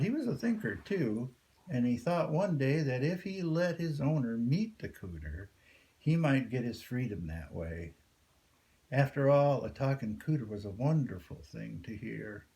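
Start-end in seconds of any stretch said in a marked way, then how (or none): none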